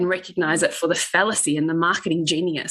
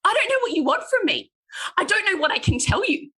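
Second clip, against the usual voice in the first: high, fast, fed-up retort; telling the barista she gives up, high exasperated voice; 13 semitones higher, faster than usual. Retold or imitated. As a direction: throw up your hands and give in. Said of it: "I went".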